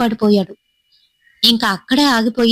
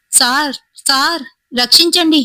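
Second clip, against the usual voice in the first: calling for help; 6 semitones higher than usual. Acted. Fleeing boy begs the signalman to save him, desperate cry. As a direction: shout for the cabin man to save you. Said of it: "గట్టిగానైతే అరుస్తున్నాను".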